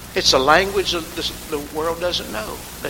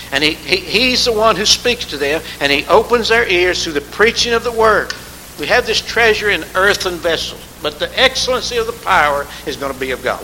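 louder, driving preacher's cadence; fervent preaching; 3 semitones higher, 5 dB louder than usual. Acted. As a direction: preach it out to the congregation. Preaching at full force.

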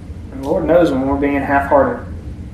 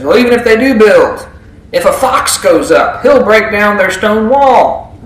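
raised voice, scornful jab at the wall; mocking sneer; 5 semitones higher, 5 dB louder than usual. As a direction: sneer at flimsy building. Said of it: "he's gonna mock their building integrity".

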